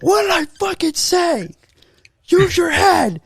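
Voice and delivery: high, loud mock coach bellowing at his player; furious coach yelling. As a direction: scream it like a coach losing his temper.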